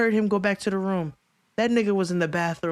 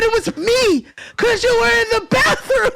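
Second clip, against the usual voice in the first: much higher and louder, bursting out; excited; 14 semitones higher, 5 dB louder than usual. Retold or imitated. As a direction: blurt it out half laughing. Excited retelling to George.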